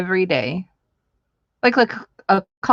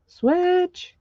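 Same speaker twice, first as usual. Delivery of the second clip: much higher, sing-song repeat; playful squeak; 12 semitones higher than usual. Real, not acted. Crafter swapping napkins, repeating Switch in a playful squeak. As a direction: chirp the word Switch up high and silly.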